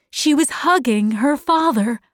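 usual speaking voice

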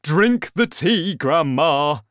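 loud, cheerful coaxing; smiling encouragement. A narrator's reading. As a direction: urge her on with a big smile. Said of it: "with an enormous smile on his face".

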